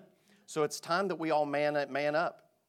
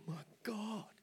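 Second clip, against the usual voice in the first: panicked gasp; 9 semitones higher, 8 dB quieter than usual. Retold or imitated. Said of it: "I'm not gonna sit there and go".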